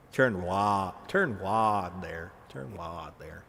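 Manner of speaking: low southern drawl; deliberate low southern twang; southern accent. Shown as a demonstration. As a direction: stretch the vowels in a slow southern accent. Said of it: "there's my southern accent coming out".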